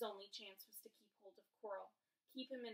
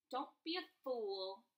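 a louder snarl; 3 semitones higher, 9 dB louder than usual. A narrator's reading. Voldemort warns Harry, snarling. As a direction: bite off the warning. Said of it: "snarled the face".

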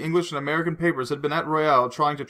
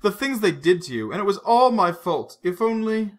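higher, louder, close to tears; tearful distress; 7 semitones higher, 4 dB louder than usual. A narrator's reading. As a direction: break down over her guilt. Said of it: "Her eyes filled with tears".

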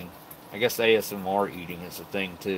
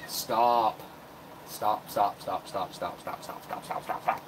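fading to whisper; 5 dB quieter, much slower than usual. Real, not acted. Repeatedly telling cat stop.

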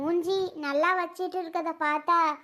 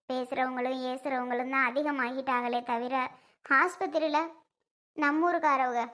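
normal talking voice

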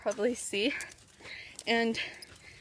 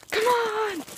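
high, loud urging call; high loud urging cry; urging shout; 10 semitones higher, 5 dB louder than usual. Real, not acted. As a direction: push the horse on with a sharp call. Urging a stubborn horse.

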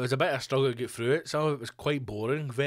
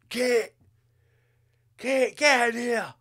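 much higher and louder, calling through the house; hammed-up angry husband summoning his wife; yelling for wife; 10 semitones higher, 4 dB louder, slower than usual.

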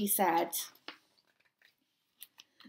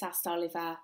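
plain reading voice

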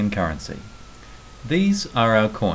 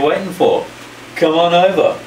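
loud high come on over; big shout; 7 semitones higher, 6 dB louder than usual. Acted. Inviting tourists to Australia, loud excited call.

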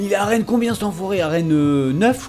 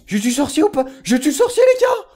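excited shout; 11 semitones higher than usual. Real, not acted. Gamer telling his viewers he killed the wizard, excited shout. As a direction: burst out with the news.

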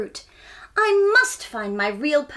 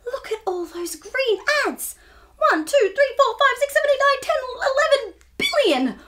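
higher and louder, counting with a laugh; excited counting; 14 semitones higher, 4 dB louder than usual. Acted. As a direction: count them up in growing delight. Counting ants excitedly for children.

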